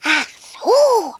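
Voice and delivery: a little dragon's loud hiss. Acted. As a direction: dragon Rüdiger hisses at the girl. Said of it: "fauchte Rüdiger".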